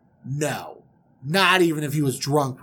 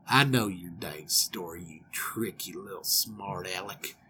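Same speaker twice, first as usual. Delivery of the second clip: menacing uncle; 9 semitones lower, 10 dB quieter than usual. Acted. Uncle exposing his tricky nephew, quiet menace. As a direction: let him know quietly you've caught him.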